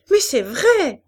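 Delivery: high, loud, indignant exclamation; child's cry of protest. A narrator's reading. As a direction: cry out like a protesting little girl. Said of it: "s'écria l'enfant".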